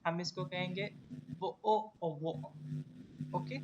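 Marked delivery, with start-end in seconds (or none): 1.4-2.5 "वो ओ ओ वो ओ": bottle of water with glottal stops for Ts; British accent, drop each T into a glottal catch. Shown as a demonstration. Teacher showing class British bottle of water, T's silent. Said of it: "टी होता है उसमें हम उसको बिल्कुल ही silent कर देते".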